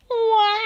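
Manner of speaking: high wailing howl of a sad fox. Acted. Fox left tied to the tree, howling wail. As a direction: throw the head back and wail. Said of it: "threw his head back and howled".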